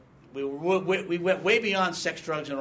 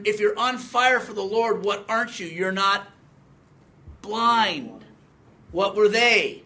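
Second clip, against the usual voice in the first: normal talking voice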